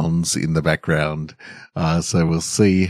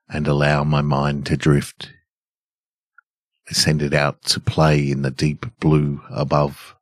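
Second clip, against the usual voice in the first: story narration; 2 semitones lower than usual. A narrator's reading. Calm relaxing story reading.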